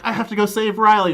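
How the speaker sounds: high, loud mock urgency; loudly put-on heroic urgency; urgent retold quote. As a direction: imitate a heroine rushing to the rescue. Voicing Buffy in the retelling.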